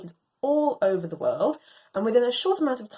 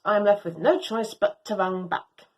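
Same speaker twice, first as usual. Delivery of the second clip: plain reading voice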